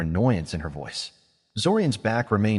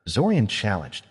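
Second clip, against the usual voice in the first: ordinary delivery, nothing marked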